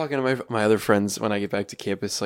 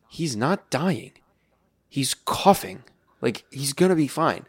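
firm insistence; 5 semitones higher, 5 dB louder, slower than usual. Retold or imitated. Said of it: "I'm like".

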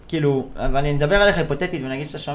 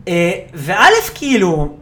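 normal talking voice

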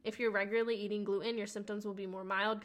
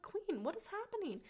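high, dramatic disbelief; mock bafflement; 10 semitones higher, 4 dB quieter than usual. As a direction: squeak it out totally baffled. Baffled at no symptoms again.

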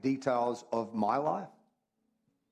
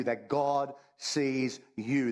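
each word slowed right down and pressed; slow emphasis; much slower than usual. Acted. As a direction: let it land slowly, word by word. Preacher stresses God sees you.

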